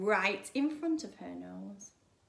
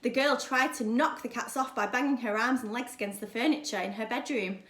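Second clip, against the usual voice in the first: plain reading voice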